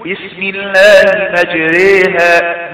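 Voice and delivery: slow recitation of مجريها, the ra thin and leaning toward i; imala recitation. Shown as a demonstration. Teacher reciting the verse again, slow and careful. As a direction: draw out مجريها with the bent vowel. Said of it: "لا تقول".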